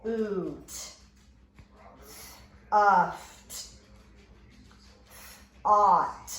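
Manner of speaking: teacher trying each vowel sound in turn. Shown as a demonstration. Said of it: "let's think about".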